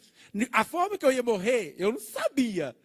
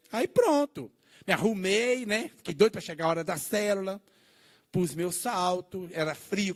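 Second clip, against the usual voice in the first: ordinary delivery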